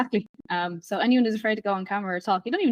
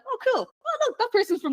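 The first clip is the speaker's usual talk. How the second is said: much higher, delighted discovery; light up as if spotting someone from nearby; imitated pleased surprise; 14 semitones higher than usual. Voices listener delighted by familiar accent.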